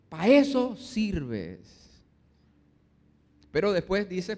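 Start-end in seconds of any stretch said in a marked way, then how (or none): 0.1-1.8 very slow, louder, spat-out insult; husband sneering at his wife that she is useless; contemptuous insult. Acted. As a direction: drag each word out with scorn. Husband insulting wife's cooking.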